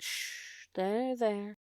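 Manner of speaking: soft hushed comfort; calm her like a child; soothing hush. Acted. Louise soothing crying Cook.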